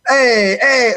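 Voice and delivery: playful put-on Italian accent; lightly hammed-up Italian accent. Shown as a demonstration. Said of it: "do my best Italian accent".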